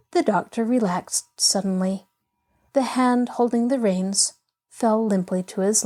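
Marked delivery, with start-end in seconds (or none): none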